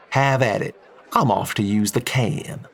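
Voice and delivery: easy Texan oil man, friendly and blunt; a folksy Texan businessman chatting; Texan drawl. Acted. Texan takes his leave.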